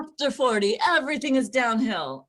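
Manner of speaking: loud, sure declaration; definite tone. Pretend. Mirror affirmation gone wrong, loud and certain. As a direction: state it with chef certainty. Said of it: "that same definite tone".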